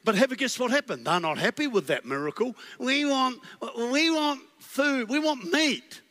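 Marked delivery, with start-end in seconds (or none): 2.8-6.0 higher, slow, drawn-out whine; whiny demand. Acted. Israelites whining to Moses for meat, mock whine. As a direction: demand it like a sulking child.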